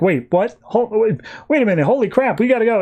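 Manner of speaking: high-pitched mock astonishment; startled realization. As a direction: imitate a crony suddenly catching on, flustered. Small guy suddenly realizes.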